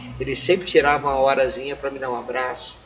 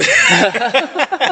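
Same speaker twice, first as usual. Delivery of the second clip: loud burst of laughter; 11 semitones higher, 12 dB louder than usual. Real, not acted. Laughs at the woman's pitch.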